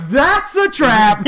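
loud, high, drawn-out imitation; stretched mock alarm; mock warning cry. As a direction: call out the warning slowly and hammed-up. Retelling friend's warning, hammed up.

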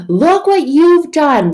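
slow, loud, high-pitched blame; mock outraged accusation; accusing shout. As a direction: point the blame and yell. Acts out blaming others.

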